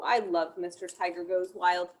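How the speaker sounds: plain reading voice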